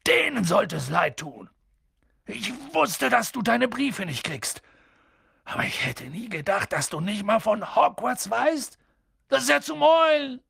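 worked-up giant, rising in pitch; outraged giant. A narrator's reading. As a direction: fume with mounting disbelief. Hagrid outraged at the Dursleys.

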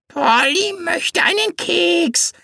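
very high, slow parrot squawk; a cowed parrot playing nice. A narrator's reading. Parrot voiced. (sehr hohe Papageienstimme)

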